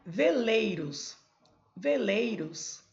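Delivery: slowly, syllable by syllable; slow syllable reading. Shown as a demonstration. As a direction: spell out Veleiros slowly, Ve-lei-ros. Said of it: "Colocar aqui".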